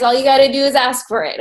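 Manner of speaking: ordinary talk, nothing marked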